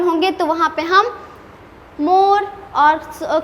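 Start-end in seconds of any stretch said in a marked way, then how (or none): none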